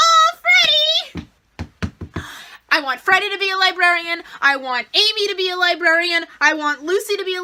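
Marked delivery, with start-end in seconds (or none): 0.1-1.3 drawn-out, high fangirl gush; gushing squeal. Retold or imitated. Fan reliving her reaction to Freddy, a slow high gush. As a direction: stretch it out, melting over Freddy. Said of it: "and I'm like".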